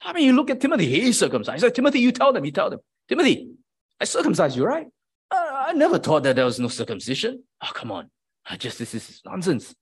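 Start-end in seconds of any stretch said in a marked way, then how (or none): 1.7-7.4 calling on Timothy, pitched high and insistent; indignant appeal. Pretend. A pretend Paul demanding Timothy back him up, high and indignant. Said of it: "he could have responded". 7.6-9.7 softer, brushing it off as nonsense; dismissive. Pretend. A pretend proud Paul waving off the accusers, dismissive and softer. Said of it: "And then just dismiss it".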